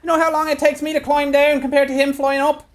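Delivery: very high, shouted cry from aloft; crow's nest lookout. Acted. Fellow high in the crow's nest to those on deck, high-pitched cry out. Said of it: "there is a fellow in the crow's nest".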